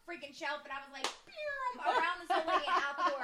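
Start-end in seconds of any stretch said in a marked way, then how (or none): 1.2-1.6 quick high whoosh noise, mimicked; high zooming "pew". Dashing around old lady out the door, mimicked zoom. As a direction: make a loud getaway sound effect.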